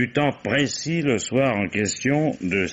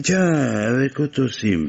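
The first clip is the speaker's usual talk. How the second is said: surprised; 7 semitones higher, 3 dB louder, slower than usual. Acted. Inspector surprised by fiancé news.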